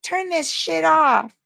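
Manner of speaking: high, slow sneer at the haters; mocking hater voice. Mocking a heckler on her live, slow sneer. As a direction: drag it out like a snotty heckler.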